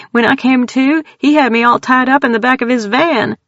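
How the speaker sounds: put-on scared country girl blurting out her terror; frightened Texan woman. Tildy tells strangers about the clown, high frightened Texan voice.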